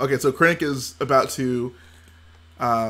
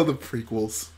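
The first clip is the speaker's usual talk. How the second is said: usual speaking voice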